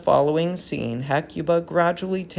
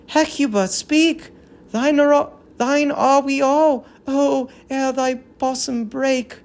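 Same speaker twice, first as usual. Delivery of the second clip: higher, pressing plea to the queen; urgent plea; 10 semitones higher, 4 dB louder than usual. Acted. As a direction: beg her to speak before she breaks. Leader pleads with Hecuba.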